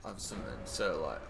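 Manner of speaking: normal talking voice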